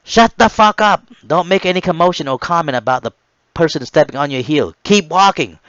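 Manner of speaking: loud, high furious yelling; angry drill shout. Retold or imitated. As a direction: scream at the stumbling recruits. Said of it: "had loudly instructed".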